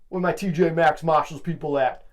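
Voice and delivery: where-my-people-at shout; hype call-out. Acted. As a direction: call the fans out like a hype man. Calling out to the audience.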